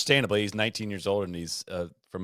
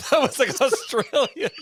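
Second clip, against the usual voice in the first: laughing; 6 dB louder, slower than usual. Real, not acted. Co-host cracking up at accent.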